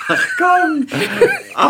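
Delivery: hoch und lachend rausgerufen; lachend ungläubig. Real, not acted. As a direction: lachend abwinken. Host teasing, laughing. (ungläubig lachend)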